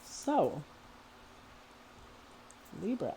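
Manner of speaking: talking while chewing gum. Real, not acted. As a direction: speak around a wad of gum. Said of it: "I'm sorry that I'm chewing gum in your ear".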